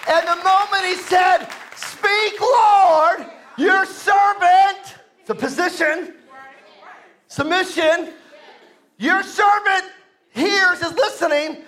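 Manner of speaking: shouted preaching, high and loud; preaching at full force. Acted. Preacher rousing the church, shouting. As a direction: build the congregation up at full voice.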